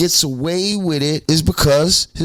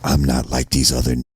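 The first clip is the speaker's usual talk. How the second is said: much lower, mock-prissy voice; 13 semitones lower than usual. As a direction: put on a low, prim tone. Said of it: "Before you were like".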